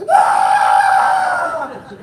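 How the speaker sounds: very high, loud falling scream; death scream. Shown as a demonstration. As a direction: scream as you're thrown off a building. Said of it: "death screams".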